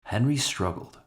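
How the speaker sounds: ordinary delivery, nothing marked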